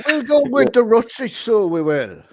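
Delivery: loud, hammed-up Irish accent; mock Irish accent. Quiz player parodying an actor's accent, mock Irish. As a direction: put on a jolly stage-Irish voice. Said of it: "Irish accent".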